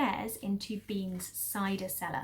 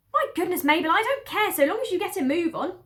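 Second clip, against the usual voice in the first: higher, louder impatient lady; exasperated mistress; 8 semitones higher, 8 dB louder than usual. Acted. Lady upstairs loses patience with the cellar woman. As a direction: huff and hurry her up. Mrs Bean voice.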